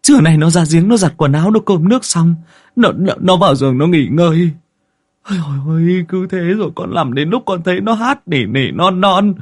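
high, stammering frightened account; scared man pleading with the master; terrified villager. A narrator's reading. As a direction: pour it out in a panicky high wail. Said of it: "Anh Tín đã hại lắm".

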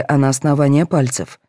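plain reading voice

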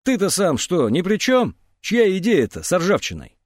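high, defensive snapping back; snappish retort. A narrator's reading. Андрей turns the blame back on his accuser, snapping. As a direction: throw the blame straight back. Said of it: "Андрей огрызнулся".

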